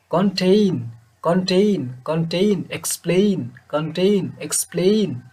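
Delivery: Contain and explain with the last syllable held long, then falling; stressed second syllables. Shown as a demonstration. Teacher drilling stressed syllables, long final syllable in contain and explain. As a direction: lean on ten in contain and plain in explain, stretching them. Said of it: "একটু লঙ্গার, একটু higher, একটু loudly".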